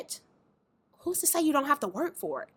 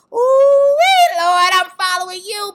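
gleeful eagerness; 7 semitones higher, 12 dB louder than usual. Retold or imitated. Believer who thinks she looks cute telling the Lord, gleeful. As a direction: sing out your mock-eager devotion.

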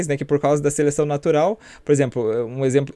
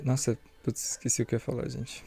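drifting softer into silence; trailing off; 10 dB quieter than usual. Real, not acted. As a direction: voice fading as she forgets. Forgets her point mid-sentence. (falando cada vez mais baixo)

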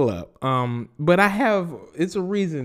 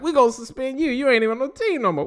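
higher, quick mock official; mock league voice; 11 semitones higher, faster than usual. Host mocking the league suspending a cut player, high and quick. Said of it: "They were like".

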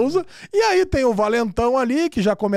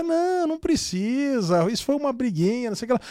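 softer, stretched-out dismissive whine; spineless husband; 3 semitones higher, 4 dB quieter than usual. Retold or imitated. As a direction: play a wimpy husband brushing it off. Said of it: "no banana do, do marido".

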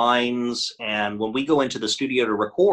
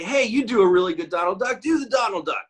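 retold friends' request; 7 semitones higher, 4 dB louder than usual. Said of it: "my friends would say".

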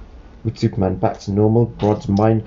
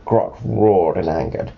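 ordinary delivery

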